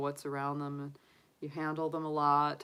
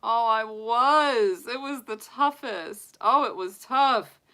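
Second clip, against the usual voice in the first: emotional outburst; 9 semitones higher, 9 dB louder than usual. Real, not acted. Emotional recalling rabbit's death.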